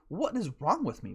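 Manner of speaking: ordinary talk, nothing marked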